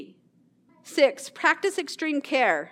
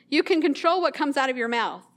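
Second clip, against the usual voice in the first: ordinary talk, nothing marked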